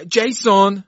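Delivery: high, loud call of a name. A narrator's reading. Servant calls to Jason outside his room, calling out. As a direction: call through a door to someone. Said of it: "صدا زد".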